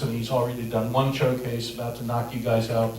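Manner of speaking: ordinary delivery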